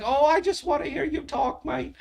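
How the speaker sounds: high mock Aussie woman's voice; imitating Australian women, high Aussie accent. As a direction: coo it like a smitten Australian woman. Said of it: "I got the accent".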